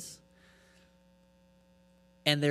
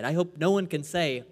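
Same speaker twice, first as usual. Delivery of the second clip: ordinary talk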